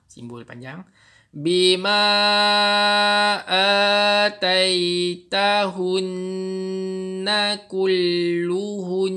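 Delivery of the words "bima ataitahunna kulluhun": slow, drawn-out chanted recitation; Quran recitation. Shown as a demonstration. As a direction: recite it slowly in tajwid style. Recites with long vowel.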